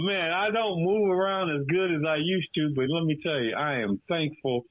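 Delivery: usual speaking voice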